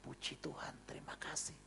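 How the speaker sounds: drowsy whispered thanks; setengah sadar. Retold or imitated. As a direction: whisper it half asleep. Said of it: "setengah sadar, setengah enggak". (bisikan syukur setengah sadar)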